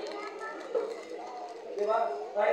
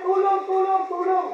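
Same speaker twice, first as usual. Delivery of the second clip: cry for help; 7 semitones higher, 4 dB louder than usual. Retold or imitated. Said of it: "sa inulit niya ulit".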